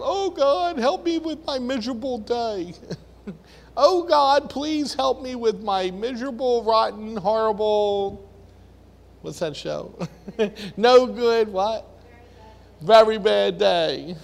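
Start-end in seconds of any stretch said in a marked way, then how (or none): 0.0-2.7 high, hammed-up whiny wail to God; mock crying out. Mocking Paul crying out to God in a high whiny wail. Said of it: "he's crying out". 3.8-8.3 high, hammed-up whiny wail to God; mock crying out. Mocking Paul crying out to God in a high whiny wail. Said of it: "he's crying out". 10.8-14.1 high, louder hammed-up whine; mock crying out. Mocking Paul's complaint to God in a loud whine. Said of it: "he's crying out".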